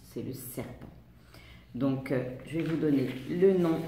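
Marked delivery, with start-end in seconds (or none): 0.0-0.9 serpent whispered, the S hissing; hissed S. Shown as a demonstration. Teacher to pupils, whispering serpent with a hissing S. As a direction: breathe it out with a snake hiss. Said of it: "pour le son S".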